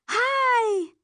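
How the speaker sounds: high, loud happy greeting; light up with a cry of hi; excited exclaim. A narrator's reading. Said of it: "Tammy exclaims".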